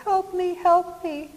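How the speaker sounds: high, tiny mock cry for help; little bitty voice. Preacher voices a man crying from a hidden pit, tiny high voice. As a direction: imitate a faint cry from far down, pitched right up. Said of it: "crying out with a little bitty voice".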